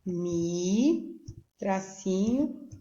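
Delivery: "Mi" held long as a separate syllable. Shown as a demonstration. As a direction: stretch "Mi" out on its own. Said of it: "você vai fazer assim".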